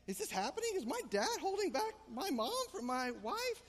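stunned disbelief. Retold or imitated. Disbelief during family fight.

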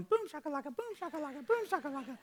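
high-pitched little-kid chant, imitated; wild toddler imitation. Dad imitating his defiant two-year-old running around, wild high chant. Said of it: "starts running around wild".

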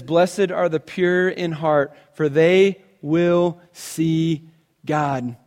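slow, weighty recitation; solemn scripture. Acted. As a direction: let each word land reverently. Quoting Jesus in sermon.

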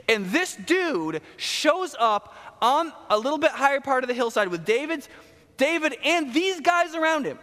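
excited storytelling. Real, not acted. Pastor telling of Shimei on the hillside, excited and high. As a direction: paint the crazy man on the hill with rising excitement.

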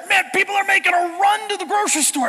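high, loud imitation of a panicked friend; friend freaking out. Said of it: "He's freaking out".

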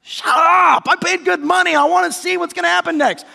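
high, loud indignant rebuke; irritated shouting. Acted. Annoyed concertgoer to the yelling neighbour, shouting angrily. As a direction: yell back at the heckler. Acted quarrel at the symphony.

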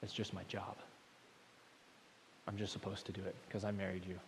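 soft, fast, offhand; husband telling his wife it's just his job, soft and offhand; dutiful, unfeeling husband. Pretend. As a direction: flatly shrug it off as duty. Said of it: "I'll say".

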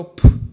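a sudden loud blown puff; loud poof. Acted. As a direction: blow it away with one sharp puff. God blows blessings away.

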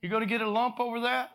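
a hint of a mocking God; teasing Lord. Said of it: "hear the Lord up there going".

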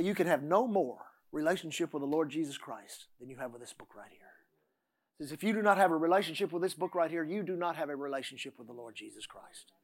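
low, subdued voice of a revered Bible teacher; quoting Mama Ward. Retold or imitated. Preacher recalls his Bible teacher to the church, low and quiet. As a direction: quote your old teacher quietly and solemnly. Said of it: "she'd do it like this".

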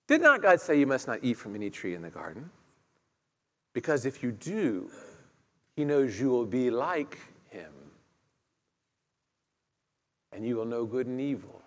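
lightly voiced tempter, coaxing low; serpent's voice. Preacher voicing the serpent tempting Eve, low and sly.

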